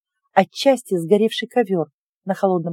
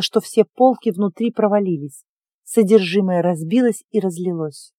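ordinary delivery

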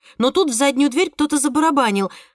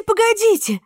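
excited, much higher; 10 semitones higher than usual. Acted. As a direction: burst out far higher. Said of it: "excited repeat, second take".

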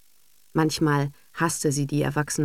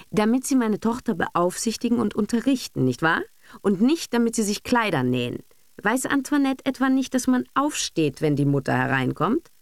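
higher, stern scolding; stern reproach; 6 semitones higher than usual. A narrator's reading. Mother sternly scolding the governess about her duties. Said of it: "setzte Madame Kampf streng an".

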